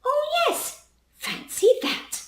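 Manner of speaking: put-on very high wispy old lady voice; a dotty old lady, airy and high; grandma voice. Grandma pretending to remember the Queen's tea, very high and airy.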